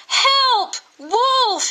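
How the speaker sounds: high, loud fake alarm cry; boy crying out. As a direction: yell it high like a boy faking panic. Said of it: "he cried".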